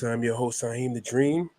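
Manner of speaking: normal talking voice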